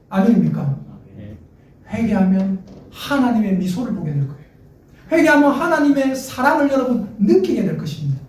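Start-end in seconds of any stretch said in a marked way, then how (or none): none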